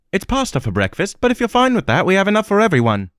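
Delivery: put-on a cheerful girl offering food, pitch lifted; young woman's voice.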